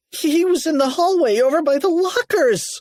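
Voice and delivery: high, panicky voice; frightened cry. A narrator's reading. Harold telling cheerleaders of ghost, high panicked cry. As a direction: blurt it out high and scared. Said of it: "cried Harold".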